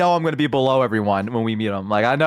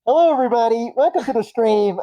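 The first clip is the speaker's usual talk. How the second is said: muffled; 8 semitones higher than usual. Pretend. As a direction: voice muffled behind a cardboard box. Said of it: "muffled because you're behind the cardboard".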